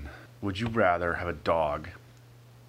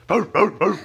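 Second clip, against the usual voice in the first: cat doing dog barks; 9 semitones higher, 10 dB louder than usual. Pretend. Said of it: "kitty cat that just goes".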